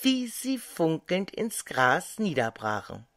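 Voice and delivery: plain reading voice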